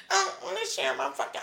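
laughing softly. Real, not acted. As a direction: laugh through the confession. Laughing admission.

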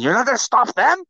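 venting real outrage at a pitch well above normal; riled-up shouting of disbelief; real anger. Real, not acted. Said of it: "I'm pissed right now".

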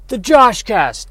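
slow, high, booming show title; booming kids show opener. Acted. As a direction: announce the show name like a kids' TV host.

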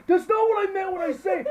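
very high, loud mock protest; frantic coach defending himself. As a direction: imitate a flustered coach backpedaling at full volume. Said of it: "he's, like, defending himself".

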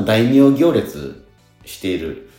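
ordinary delivery

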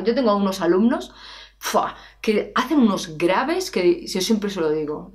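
ordinary delivery

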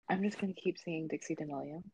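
ordinary talk

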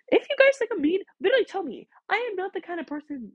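exasperated high-pitched outburst; worked-up yelling. Real, not acted. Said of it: "I didn't mean to yell that much".

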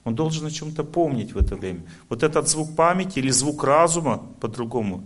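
usual speaking voice

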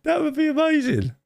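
very high, squeezed out after a laughing fit; high-pitched, tickled squeal of words; real helpless laughter. Real, not acted. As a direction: barely get it out for laughing.

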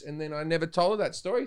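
ordinary talk

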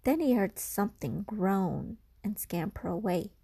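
usual speaking voice

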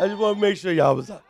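low, soft and quick; offhand aside. Real, not acted. Preacher checking that y'all was up, in a casual aside. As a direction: toss it away under the sermon. Explains joke to congregation.

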